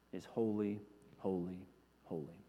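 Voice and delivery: low, softening repetition; quietly awed, fading away; reverent fade. Acted. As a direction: let each word drop into a hush.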